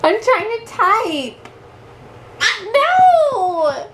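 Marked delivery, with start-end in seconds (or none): 2.7-3.9 high laughing shout; being tickled. Real, not acted. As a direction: squeal it while tickled. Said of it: "I have a tickle monster".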